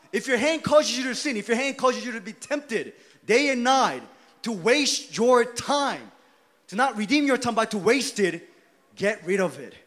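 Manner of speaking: loud, high preaching that builds to shouts; fiery preaching. Acted. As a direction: press the sinner hard from the pulpit.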